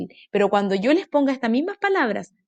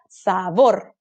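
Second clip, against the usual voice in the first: "Sabor" split as sa-BOR; stress on last syllable; 4 semitones lower than usual. Shown as a demonstration. Teacher presents the next word, "Sabor" stressed on BOR. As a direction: land firmly on BOR in "Sabor". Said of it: "Sílaba tónica".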